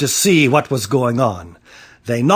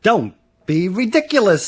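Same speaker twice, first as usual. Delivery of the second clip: dismissive scolding; 7 semitones higher than usual. A narrator's reading. Child scoffs back at adults.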